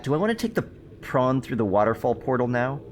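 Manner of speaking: usual speaking voice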